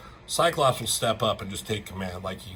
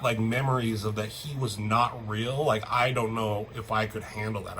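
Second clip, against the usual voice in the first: ordinary delivery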